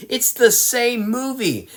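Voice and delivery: disbelieving high-pitched exclamation; exasperated outburst. Real, not acted. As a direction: throw up your hands in disbelief. Exasperated rant about the rehash.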